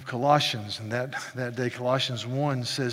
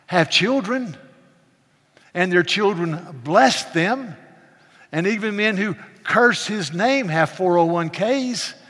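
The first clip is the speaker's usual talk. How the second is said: ordinary delivery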